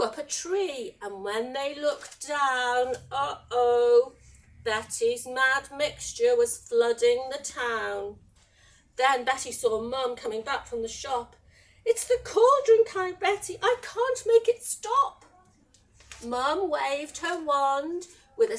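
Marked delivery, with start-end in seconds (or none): 3.1-4.1 loud, drawn-out storyteller's uh-oh; ominous uh-oh. A narrator's reading. Narrator spots the flooded town, ominous. 11.8-15.3 high, loud, urgent wail; panicked cry. Acted. Betty to Mum about the cauldron, panicked.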